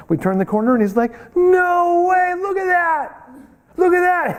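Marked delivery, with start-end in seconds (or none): 1.3-4.4 very high, wide-eyed no way; high pitched astonished exclaiming; stunned amazement. Retold or imitated. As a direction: point and gape at the sight. Doctor stunned at the clinic.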